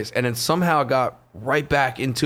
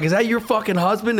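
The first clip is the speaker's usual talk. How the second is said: mocking; 9 semitones higher than usual. Rogan mocking heckler's date.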